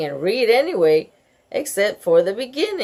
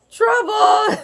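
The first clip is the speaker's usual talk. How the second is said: high, loud cry of alarm; alarmed shout; 14 semitones higher, 5 dB louder than usual. A narrator's reading. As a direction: shout it like disaster struck. Bean's alarmed aside to Ivy.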